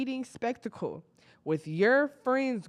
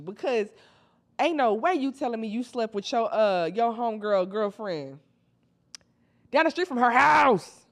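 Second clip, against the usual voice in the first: ordinary talk, nothing marked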